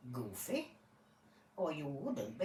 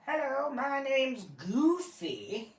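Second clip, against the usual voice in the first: lightly voiced cartoon dog, slow and higher; Goofy voice; 6 semitones higher, 3 dB louder, slower than usual. Goofy introduces himself to the judge, higher and slower. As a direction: play Goofy greeting the court, slow and high. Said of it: "a dog or Goofy?".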